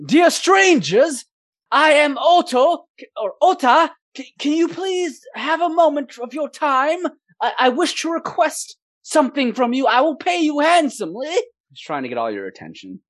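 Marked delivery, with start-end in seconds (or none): 0.1-11.3 put-on nervous young man. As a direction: plead politely in a high voice. GM voices young nervous Otto.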